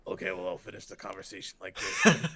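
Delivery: lightly done gravelly Bale whisper-growl; a hint of witness-protection Bale.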